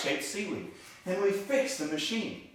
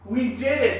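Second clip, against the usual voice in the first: excited; 7 semitones higher, 8 dB louder than usual. Real, not acted. Celebrating a finished task.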